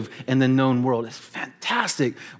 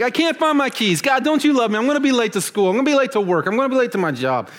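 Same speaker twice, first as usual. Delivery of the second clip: much higher, whining mock panic over lost keys; hammed-up whine to God, voice up high; mocking a panicked teen who lost his keys; whiny complaint; 8 semitones higher, faster than usual. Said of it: "You're like".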